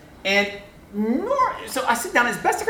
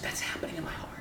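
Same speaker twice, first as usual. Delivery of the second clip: breathy whisper, much softer; hushed confession; 16 dB quieter than usual. Retold or imitated. Professor confiding change of heart, whispered. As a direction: whisper it like a secret.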